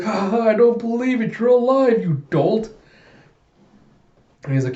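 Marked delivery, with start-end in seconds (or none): 0.0-2.7 much higher, louder happy cry; half-laugh, half-cry of relief; crying out in joy. Acted. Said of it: "And she's just crying out".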